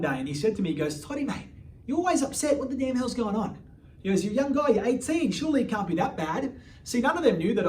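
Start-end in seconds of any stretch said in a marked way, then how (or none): 1.0-3.6 higher, lightly put-on British mate voice; a hint of a concerned British cellmate; British inmate voice. British cellmate asking a moody young inmate what's wrong, in a light British voice. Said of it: "this British guy who was facing". 4.2-6.6 British inmate voice. Retold or imitated. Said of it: "this British guy".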